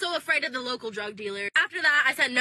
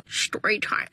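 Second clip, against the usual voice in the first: story time with the pitch dropped lower; 6 semitones lower, slower than usual. Shown as a demonstration. Trying one more intro take, lower. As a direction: drop the voice low for story time. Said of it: "Oh, not the laugh".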